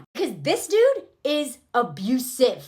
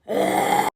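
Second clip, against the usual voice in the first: rough, voiceless "ugh"; mock disgusted grunt. Man answering her challenge, mock exasperated grunt. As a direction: imitate his grunt of frustration. His reaction, acted as noise.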